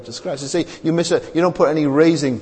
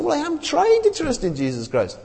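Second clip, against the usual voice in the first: whiny doubter; 9 semitones higher than usual. Retold or imitated. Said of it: "You say".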